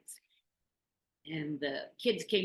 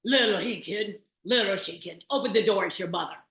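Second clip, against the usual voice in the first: loud, rough wolf singing the mother's song; a wolf's loud singing attempt at a mother's song; good wolf voice; 3 semitones higher, 10 dB louder than usual. A narrator's reading. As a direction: belt the rhyme out like a wolf faking a mother. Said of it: "he sang out in his good wolf voice".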